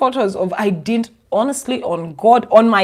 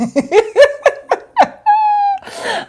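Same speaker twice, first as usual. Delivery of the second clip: long high cackle; high laughing fit. Real, not acted. Cracking up at her own joke, high laughing fit. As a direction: laugh till it almost cries.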